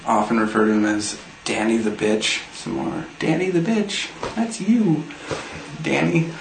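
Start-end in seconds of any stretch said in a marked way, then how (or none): 3.2-6.3 high mocking sing-song; taunting. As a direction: call him out teasingly. Taunting absent friend on camera.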